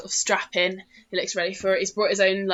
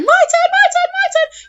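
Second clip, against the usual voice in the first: very high, louder clamoring; eager gnome kids; 7 dB louder than usual. Acted. Gnomes clamoring for the catapult, very high and loud. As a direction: beg like a bouncing little gnome.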